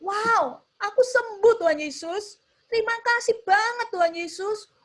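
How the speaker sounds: high, elated thanks shouted out; joyful shout. Acted. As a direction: cry out overjoyed at being healed. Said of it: "dia teriak ya".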